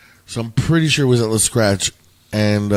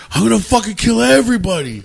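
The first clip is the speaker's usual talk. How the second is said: much higher and louder furious bellow; enraged roar; 11 semitones higher, 6 dB louder than usual. Retold or imitated. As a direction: roar it like a berserk drunk. Huge guy raging again.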